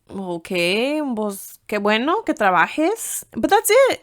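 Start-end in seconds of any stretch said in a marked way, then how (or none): 3.3-4.0 incredulous. Real, not acted. Mocking curt answer.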